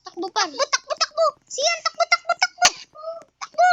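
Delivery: high frantic calls to run; urgent, scared, yelling to flee; panicked yelling. Real, not acted. Monster got in, fleeing.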